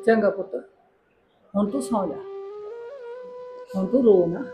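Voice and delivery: soft bedtime reassurance; hushed, motherly comfort; soothing. Acted. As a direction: settle her to sleep very gently. Comforts crying girl, sends to sleep.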